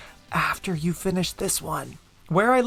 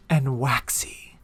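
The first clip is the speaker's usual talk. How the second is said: whisper; 4 semitones lower, much slower than usual. Acted. Whispered spooky aside.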